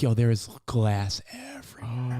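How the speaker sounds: hushed, slow worried whisper; whispered alarm. Retold or imitated. Dad spotting glass, whispering worry. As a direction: whisper it so the kid won't hear.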